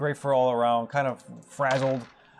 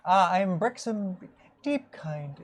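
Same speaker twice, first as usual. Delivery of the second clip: a hint of the old rabbit; 5 semitones higher, slower than usual.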